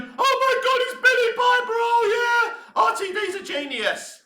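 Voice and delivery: very high squealing fan voice; imitating gushing fans at a cameo; mocking gushing fans. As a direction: parody the fans' excitement. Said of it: "everyone's gonna be going".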